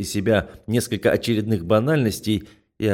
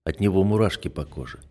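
usual speaking voice

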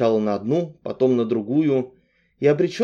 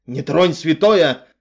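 loud, slow bark; loud slow warning; 5 semitones higher, 6 dB louder, slower than usual. A narrator's reading. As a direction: snap to guard the book. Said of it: "рявкнула так".

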